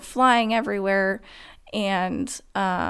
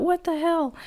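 stunned disbelief; 9 semitones higher than usual. Retold or imitated. Thinking after the crash.

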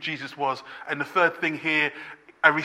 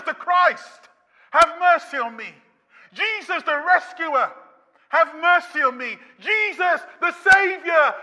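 voiced pleading that climbs higher and louder with each plea; a beggar calling louder and louder; pleading cry; 10 semitones higher, 6 dB louder than usual. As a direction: cry out to Jesus, building every time. Preacher voicing the blind man's cry.

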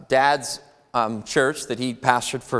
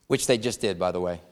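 normal talking voice

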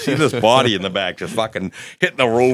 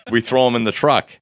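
ordinary talk

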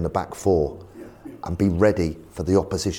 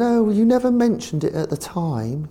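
posh chairman; 13 semitones higher, 3 dB louder than usual. Retold or imitated. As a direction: put on a plummy chairman voice. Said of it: "He's very posh".

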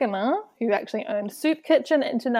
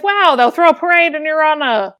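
higher, louder faux-impressed jab; retelling a friend's teasing, mock amazed and louder; mock sarcastic awe; 6 semitones higher, 8 dB louder than usual. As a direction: pile on the sarcasm like he's a saint. Friend mocks his dating advice.

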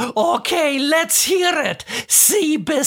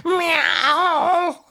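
cat meow. Pretend. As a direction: answer with your own meow. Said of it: "Do it again".

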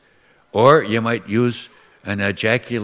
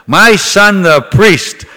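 indignant mother; 6 semitones higher, 11 dB louder, slower than usual. Retold or imitated. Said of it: "And she says".

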